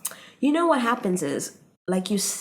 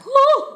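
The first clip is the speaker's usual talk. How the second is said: high, loud mock-impressed "woo"; sudden high "woo" imitating an awed listener; impressed whoop; 10 dB louder than usual. Mimics suddenly being impressed by accent.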